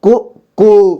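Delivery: first को short, second को held long; short then long. Shown as a demonstration. Teacher shows small and big u, short then drawn out. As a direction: contrast the vowel length in को, को. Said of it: "बड़ा ऊ को ऐसे ही पढ़ेंगे".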